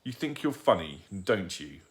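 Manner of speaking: plain reading voice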